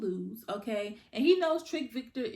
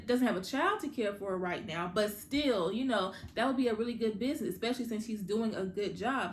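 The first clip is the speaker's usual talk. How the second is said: ordinary delivery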